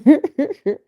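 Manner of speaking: a loud high giggle; a bright burst of laughter; real laughing. Real, not acted. Laughs at own demo.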